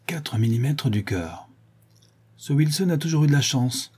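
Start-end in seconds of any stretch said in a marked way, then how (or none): 2.4-3.9 higher-pitched, mildly envious remark. A narrator's reading. Sholmes hearing Wilson narrowly survived, mildly envious. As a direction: conclude it with a touch of envy. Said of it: "conclut Sholmes d'un ton d'envie".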